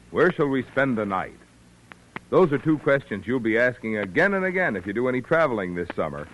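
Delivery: usual speaking voice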